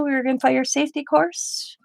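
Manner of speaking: ordinary delivery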